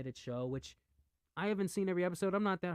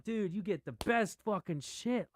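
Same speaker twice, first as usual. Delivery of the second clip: ordinary delivery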